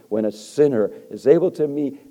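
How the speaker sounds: loud, rising, slow-hammered preacher cry; preaching at full force. Acted. Preacher to congregation, preaching at full force. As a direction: thunder it from the pulpit.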